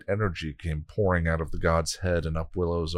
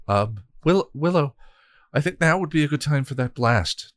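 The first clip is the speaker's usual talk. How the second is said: nervously; 7 semitones higher, 3 dB louder than usual. Acted. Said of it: "Tara said nervously".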